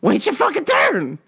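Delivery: exasperated. Retold or imitated. Store worker tells pushy customer off, mock exasperated. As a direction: act out losing patience with a customer.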